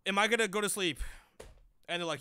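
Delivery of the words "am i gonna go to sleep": mock panicked plea. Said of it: "And I'm over there like".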